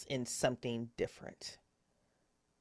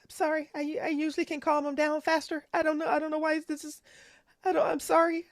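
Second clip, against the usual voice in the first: much higher, louder, frantic apology; panicked high voice; 6 dB louder, faster than usual. Acted. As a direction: babble apologies in rising panic. NPC mother panicking, apologizing.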